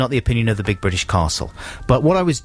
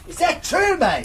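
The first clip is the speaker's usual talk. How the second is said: squeaky-high mock Aussie; incredulous Australian, shooting right up high; put-on Australian accent. Mate amazed by the sun fact, very high Australian accent. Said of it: "authentic Australian friend Adam".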